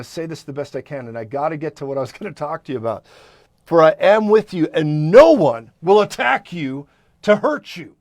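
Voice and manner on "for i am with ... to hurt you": booming and slowed, declaring the promise; loud proclamation. Acted. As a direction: thunder it out over the crowd. Preacher proclaiming God's word.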